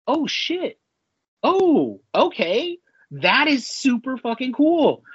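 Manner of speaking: high-pitched, reenacted amazement; amazed realization. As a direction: relive the moment it dawns on you, voice lifted high. Retelling own amazed reaction.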